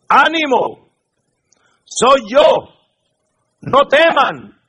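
high, loud, short separated calls; calling out encouragement. Acted. Preacher as Jesus calling to the disciples, firm reassuring call. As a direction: project each phrase firmly across the water.

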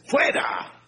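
ordinary delivery

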